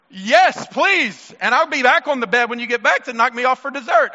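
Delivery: put-on jokingly eager, play the greedy kid; eager little sister.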